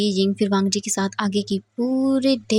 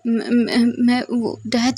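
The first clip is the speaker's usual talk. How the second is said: frightened stammer; 2 semitones higher, much slower than usual. A narrator's reading. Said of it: "वी जिंग डर जाता है और बोलता है".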